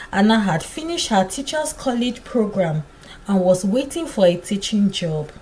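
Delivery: ordinary delivery, nothing marked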